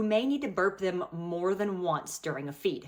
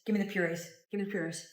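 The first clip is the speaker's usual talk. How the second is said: weary, pleading repeat of Give me the purees; begging, half-joking, worn out; exasperated plea; 4 dB quieter, faster than usual. Real, not acted. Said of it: "I can't do this".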